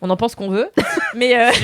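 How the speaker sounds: ordinary talk, nothing marked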